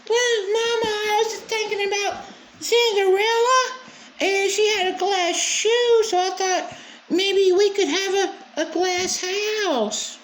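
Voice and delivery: very high, sugary mock little girl voice; mocking childish girl voice.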